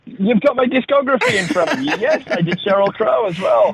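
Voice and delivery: high-pitched, laughing admission; amused protest. Real, not acted. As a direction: own up with a laugh, voice lifted high. Amused at being reminded of credits.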